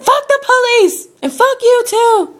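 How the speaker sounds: very high retold furious yell; angry shouting. Customer curses police and tow lady, angry shout. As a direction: yell back at the top of your pitch. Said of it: "getting more and more angry".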